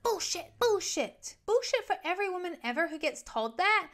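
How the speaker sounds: high-pitched mock outrage; outraged retort. Girlfriend rejecting his excuse about marriage, outraged. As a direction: call it out, high and indignant.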